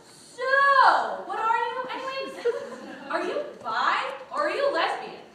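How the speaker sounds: loud, high, tactless interrogation; mock blunt questioning. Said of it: "maybe less politically correct".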